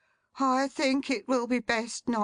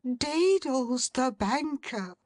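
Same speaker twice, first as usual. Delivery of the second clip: gasped; 8 semitones higher, slower than usual. A narrator's reading. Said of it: "gasped the secretary".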